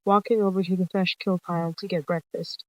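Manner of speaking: ordinary delivery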